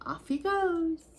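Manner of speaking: plain reading voice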